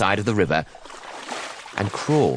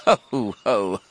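slow, hammed-up "ho ho ho"; crocodile's mocking laugh; much slower than usual. A narrator's reading. Enormous croc scoffing at the smaller one, stagey laugh. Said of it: "cried the enormous crocodile".

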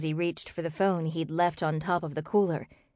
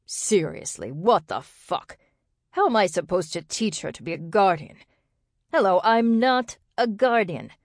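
exasperated outburst; 2 semitones higher, 4 dB louder than usual. A narrator's reading. Man protesting to Kendrick on the phone, exasperated. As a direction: protest in angry disbelief, stretching the curse. Angry phone argument, disbelief.

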